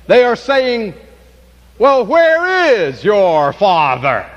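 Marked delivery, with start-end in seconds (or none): 1.8-4.3 slow, drawn-out mocking challenge; mocking question. Unbelievers mocking Jesus about his Father, mocking. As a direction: throw the question out to taunt him. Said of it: "perhaps that kind of a tone of voice".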